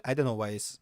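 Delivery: ordinary talk, nothing marked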